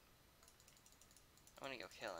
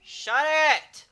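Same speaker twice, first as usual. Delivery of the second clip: angry shout; 19 dB louder than usual. Real, not acted. Streamer yelling at barking dogs outside, furious shout. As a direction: yell it, much higher and louder.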